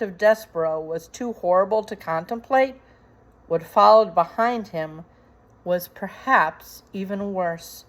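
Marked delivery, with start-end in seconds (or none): none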